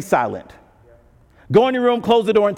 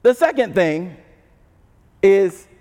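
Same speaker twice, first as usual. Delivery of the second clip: normal talking voice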